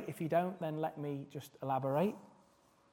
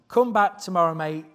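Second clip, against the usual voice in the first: put-on failed Scouse accent; 8 dB louder than usual. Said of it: "can't do a Scouse accent".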